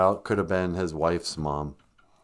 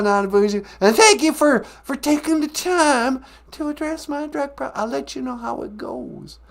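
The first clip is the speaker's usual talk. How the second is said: much higher, louder silly puppet voice; 4 dB louder than usual. Acted. Puppet asking the host one more question, high silly voice. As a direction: squeak it out like a cheeky puppet.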